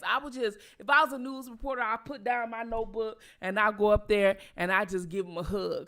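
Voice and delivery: ordinary talk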